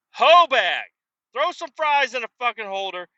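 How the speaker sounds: loud, high burst of an insult; shouted insult. Retold or imitated. Annoyed customer shouting at the kitchen, loud insult. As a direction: fling it at them.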